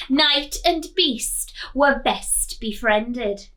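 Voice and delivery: ordinary delivery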